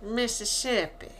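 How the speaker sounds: lightly put-on Southern accent on the state's name. Echoing the stranger's home state, mock southern accent. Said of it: "this southern accent from Mississippi".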